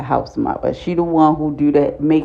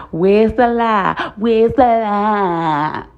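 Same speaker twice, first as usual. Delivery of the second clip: slow, drawn-out mocking taunt; smug taunt; 4 semitones higher, much slower than usual. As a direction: stretch it out, savoring the dig. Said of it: "Brazil was like".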